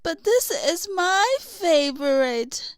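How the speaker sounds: a hint of a wistful troll grandmother; lightly voiced troll Boda. A narrator's reading. Boda tells Kristoff of her crystal, lightly voiced. Boda's dialogue in story.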